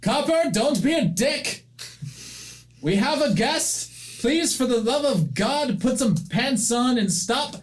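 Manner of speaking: high exasperated call to the back room; old woman scolding her tenant from afar. Acted. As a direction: holler at the lazy tenant. Calling a tenant through the house.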